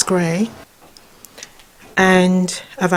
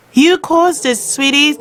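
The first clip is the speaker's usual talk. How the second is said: mock blame; 7 semitones higher than usual. Real, not acted. Owner teasing her dog that he caused this, fond mock scolding.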